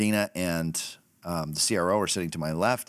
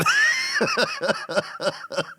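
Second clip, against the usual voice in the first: loud high burst of laughter; 3 dB louder than usual. Real, not acted. [name] laughing at his own punchline, loud high burst. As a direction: howl with laughter.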